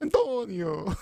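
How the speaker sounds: high, drawn-out mock Italian; failed French accent, turning Italian; Italian-sounding accent. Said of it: "That's definitely not French".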